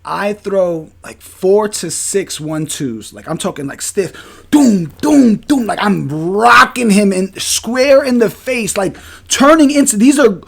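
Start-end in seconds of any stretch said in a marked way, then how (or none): 4.5-5.7 high, loud punch noises; imitated punch sound effects; mock punch sounds. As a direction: punch the air with each "doom".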